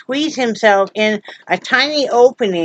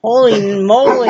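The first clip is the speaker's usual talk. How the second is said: ordinary delivery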